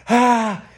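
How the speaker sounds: one loud, high, forced ha; forced sound. Shown as a demonstration. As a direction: shove the sound out of you. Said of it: "you're forcing the sound out".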